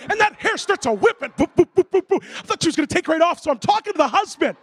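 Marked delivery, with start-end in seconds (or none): 1.3-2.2 punchy rhythmic rotor boops; imitated helicopter noise. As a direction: chop out boops like whirling blades. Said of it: "She starts doing the helicopter".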